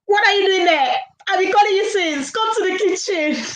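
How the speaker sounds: put-on high, summoning mother calling a child; calling mom. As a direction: call the kid down like an impatient mom.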